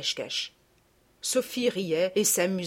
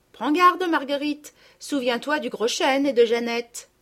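put-on higher, louder girlish warning; children warning a friend about her doll; warning little girls; 7 semitones higher, 4 dB louder than usual. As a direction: caution your little sister. Girls warn Marguerite.

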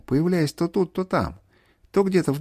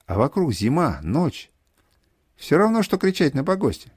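ordinary delivery

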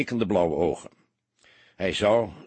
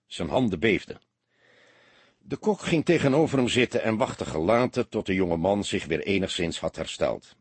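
ordinary delivery, nothing marked

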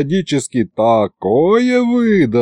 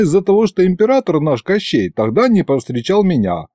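ordinary delivery

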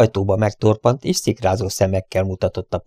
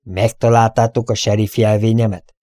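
plain reading voice

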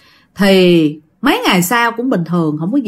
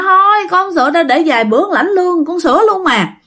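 higher-pitched, quick protest; retold stubborn refusal; 8 semitones higher than usual. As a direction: refuse in a high, hurried mock whine. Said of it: "Bạn không chịu".

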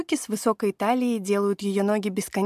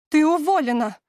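яростный выкрик; выкрикнуть в бешенстве; 6 semitones higher, 5 dB louder than usual. A narrator's reading. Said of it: "в бешенстве выкрикивает она". (в бешенстве)

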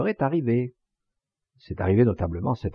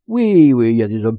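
emphatic insistence; 9 dB louder than usual. Acted. Cabissol insists Morin lives.

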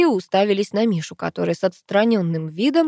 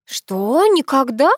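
rising, louder incredulous question; surprised; 7 semitones higher, 5 dB louder, much slower than usual. A narrator's reading. Sister learns he never saw the sea, surprised. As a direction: lift the pitch in disbelief. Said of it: "удивилась сестра".

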